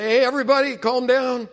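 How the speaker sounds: high, loud call over an argument, easing off at the end; calling for calm. Acted. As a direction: break up a family row. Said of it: "sometimes if there's an angry thing".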